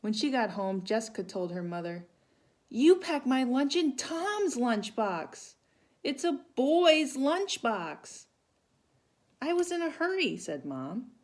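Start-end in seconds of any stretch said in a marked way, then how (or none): 2.7-8.2 put-on high, slow, loud complaining girl; upset child. 9.4-10.4 hurried mom. Acted. Mom apologizes.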